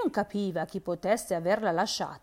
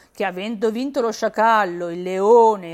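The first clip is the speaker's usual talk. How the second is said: plain reading voice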